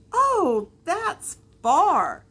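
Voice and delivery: high, loud oh of wonder; impressed. A narrator's reading. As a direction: marvel at how far that is. Big hare marvels.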